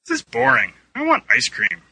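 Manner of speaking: high-pitched bored whine; whiny complaint. A narrator's reading. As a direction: complain and demand a treat. Child-like complaint during recording.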